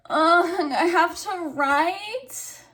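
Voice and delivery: high, drawn-out mock complaint; groaning student. Teacher voicing students who must write, high slow whine. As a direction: drag it out like a reluctant pupil. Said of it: "what you're probably all thinking".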